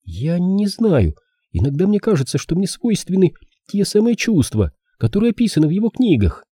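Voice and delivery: put-on high-pitched girl, hesitant start; young woman character. Girl admits her doubts to the detective, high troubled voice.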